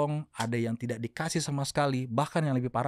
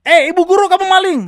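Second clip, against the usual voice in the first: high, very loud accusing shout; hammed-up accusing yell; mock accusation; 17 dB louder than usual. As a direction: shout it as if accusing a teacher outright. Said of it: "Saya tidak menuduh bahwa".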